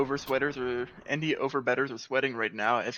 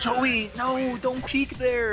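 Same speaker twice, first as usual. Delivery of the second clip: urgent callout; 11 semitones higher, 4 dB louder, much slower than usual. Real, not acted. Caster yelling at player on screen.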